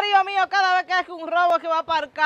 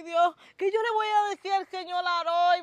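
normal talking voice

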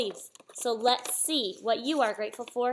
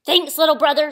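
loud, hearty storybook truck; a big truck thanking a little one; dump truck's thanks; 8 dB louder than usual. A narrator's reading. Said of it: "said Dump to Blue".

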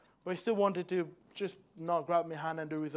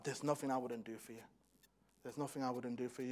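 soft, hushed promise to a newborn; quiet tender promise; tender vow; 5 semitones lower, 10 dB quieter, faster than usual. Retold or imitated. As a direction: say it softly to the baby in your arms. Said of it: "I looked at him and I said".

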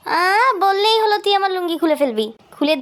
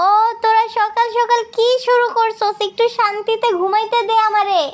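high, whining complaint; high-pitched put-upon protest; exasperated sleeper; 5 semitones higher than usual. Acted. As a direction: wail at them to let you rest. Woken sleeper complains about quarrel.

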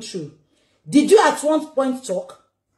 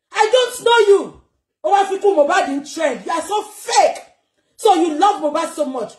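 furious rant; 5 semitones higher than usual. Real, not acted. Angry rant at [name].

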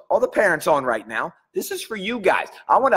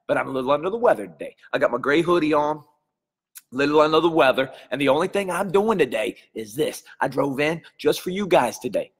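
normal talking voice

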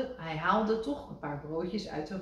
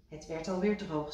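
usual speaking voice

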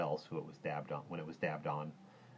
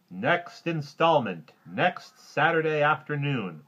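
slow, loud, high announcer delivery; big drawn-out announcer call; on-screen serial announcement; 8 semitones higher, 9 dB louder, slower than usual. Acted. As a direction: proclaim it slowly like a serial's title card. Words appearing on movie screen.

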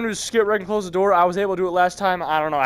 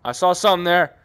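raised, firmer voice; insistent repeat. Real, not acted. Repeats it more insistently, louder.

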